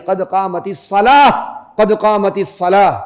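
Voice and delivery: slow قد قامت الصلاۃ, the last letter an h; correct pause form. Shown as a demonstration. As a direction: read الصلاۃ slowly and close on h. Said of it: "یہ ہا پڑ جاتی ہے".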